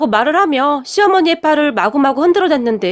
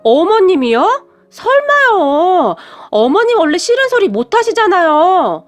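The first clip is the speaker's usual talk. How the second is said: higher, louder, stretched-out disbelief; daughter-in-law stunned, asking in slow disbelief; surprised disbelief; 5 semitones higher, 3 dB louder, slower than usual. A narrator's reading. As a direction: gasp out a slow, amazed "no way". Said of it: "제가 놀라서 물었는데요".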